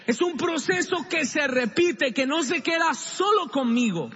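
high, loud preaching at full force. Acted. Pastor preaching forgiveness to the congregation, high loud preaching. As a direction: drive the point home from the pulpit.

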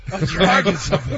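playful echo of a ranting dad; mimicked exasperated dad. Co-host echoing the dad story, playful mock exasperation.

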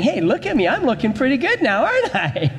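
high-pitched, showing-off lump of clay; preacher playing a vain clay pot; boastful pot of clay. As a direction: brag in a high, pleased-with-yourself voice. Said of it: "this clay starts saying".

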